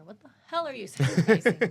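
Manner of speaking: loud laughing outburst; laughing exasperation. Retold or imitated. Mock-exasperated family complaint.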